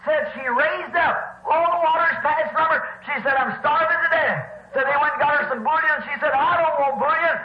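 excited report. Retold or imitated. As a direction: pour out the good news with mounting energy.

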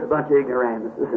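low, soft, quick dismissive aside; God's verdict. Retold or imitated. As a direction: drop it low and toss it off. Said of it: "God says".

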